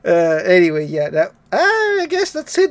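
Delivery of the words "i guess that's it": sudden high-pitched, slow wrap-up; high sing-song. Real, not acted.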